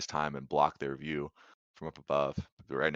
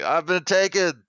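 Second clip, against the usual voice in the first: slow, high, loud wail; yelling for help; 12 dB louder than usual. Acted. Captive alerting the guards above, drawn-out high yell. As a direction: holler it out long and high. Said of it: "he's still yelling".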